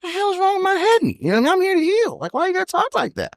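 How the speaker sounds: high, squeaky whiny protest; retold protest. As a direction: play your younger self, whining and put out. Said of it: "so I'd be like".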